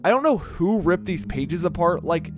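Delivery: ordinary delivery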